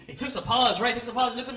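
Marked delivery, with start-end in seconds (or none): none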